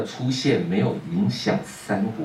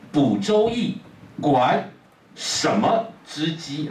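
title read slowly; 4 semitones higher, much slower than usual. Shown as a demonstration. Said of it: "这个字怎么念？".

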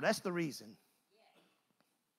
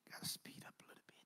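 fast, breathless whisper to himself; whispered aside; 20 dB quieter, much faster than usual. Real, not acted. Preacher reminding himself to hurry, whispered fast. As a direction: murmur it off-mic, hurrying.

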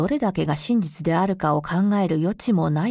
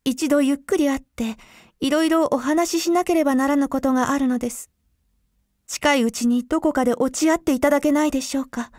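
higher, quick pleading woman; young woman voice; 6 semitones higher, faster than usual. A narrator's reading. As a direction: voice the woman, urgent and hushed. Woman's voice in read story.

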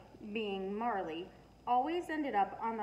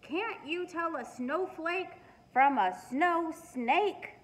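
slow, higher, louder exasperated yell; 7 semitones higher, 6 dB louder, slower than usual. A narrator's reading. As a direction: scold the dog in disbelief, drawn out. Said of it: "Cassie yelled".

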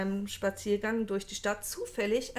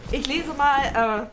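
feierliche Ansage; 5 semitones higher, 10 dB louder, much slower than usual. Acted. Pompous reading start.

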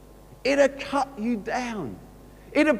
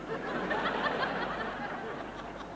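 soft breathy chuckle away from the mic; a quiet real laugh at the memory; 11 semitones higher, 10 dB quieter than usual. Real, not acted. Vicar laughing while telling the interviewer story, soft chuckle.

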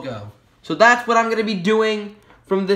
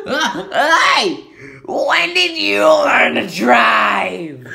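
high, loud, slow astonished yell; gasp, then shout it in disbelief; mock shocked shout at a driving dog; Vine quote; 3 semitones higher, 5 dB louder, much slower than usual. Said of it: "a Vine reference".

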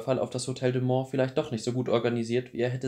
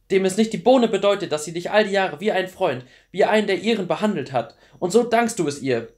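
high, loud, outraged outburst; furious tirade; 7 semitones higher, 8 dB louder than usual. A narrator's reading. Clary berates Luke for betraying her mother, furious. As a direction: berate him angrily, hammering each reproach. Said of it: "erwiderte Clary wütend".

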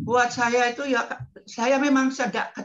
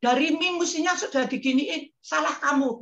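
playful scolding of God; 7 semitones higher than usual. Retold or imitated. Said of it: "saya ngomongnya".

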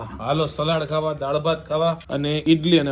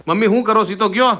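raised voice, higher, calling to another room; louder, higher call to mom; 6 semitones higher, 6 dB louder than usual. Real, not acted. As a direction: call out to mom across the house.

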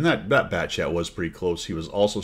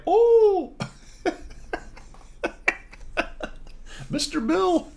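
squealing falsetto through laughter, much higher; mock squeal; much slower than usual. Player voicing the spotted enemy tank: laughing falsetto squeal. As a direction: shriek it like a cartoon victim, laughing. Mocking the enemy tank's face.

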